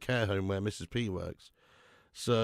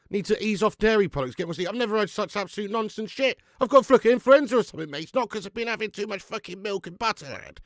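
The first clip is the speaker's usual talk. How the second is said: high, loud exasperated outburst; indignant rant; 9 semitones higher, 7 dB louder than usual. Real, not acted. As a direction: rant it loudly in high-pitched disbelief. Indignant rant at dairy advice.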